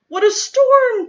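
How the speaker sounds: high, loud, chirpy exclamation; twittering wren. Acted. Wren fluttering in from the storm, high and twittering. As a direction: chirp it out like a little bird. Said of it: "twitters wren".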